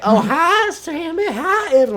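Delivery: high, sing-song tipsy greeting; drunk cousin. Retold or imitated. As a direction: play the cheerful drunk cousin. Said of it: "She's like".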